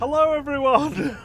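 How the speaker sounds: bursting out way too loud; an overexcited shouted hello; loud greeting. Real, not acted. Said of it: "I'm so sorry about that".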